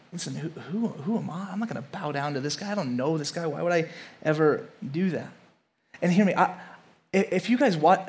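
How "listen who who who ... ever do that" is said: much softer, low scornful muttering; dismissive Pharaoh. Acted. Preacher voicing Pharaoh brushing off God, soft scornful mutter. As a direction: mutter it scornfully under your breath.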